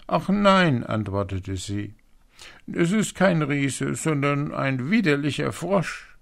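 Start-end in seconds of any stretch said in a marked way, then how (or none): 0.1-0.8 high, loud princess exclamation; upset princess. A narrator's reading. Frightened princess answers her father. 2.7-6.2 high, louder princess voice; upset princess. A narrator's reading. Princess complains about the frog.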